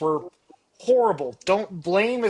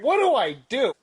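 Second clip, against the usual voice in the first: panicky first-time parent; 8 semitones higher, 3 dB louder than usual. Acted. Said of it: "like, first-time parent".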